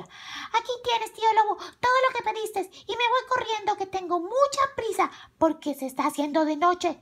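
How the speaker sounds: put-on high girlish chatter; hurried little girl. A narrator's reading. Carmela handing over the package in a hurry, high girlish voice. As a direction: rattle it off in a high child's voice.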